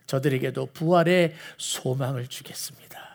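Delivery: low, soft, near-whispered pledge; hushed promise. Retold or imitated. Jesus promising resurrection hope, hushed. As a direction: drop right down to promise it quietly.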